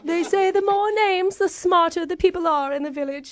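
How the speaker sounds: put-on posh British accent; a put-on English lady; fake British accent. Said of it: "English sounding".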